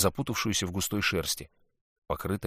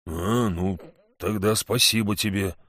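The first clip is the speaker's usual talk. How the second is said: ordinary delivery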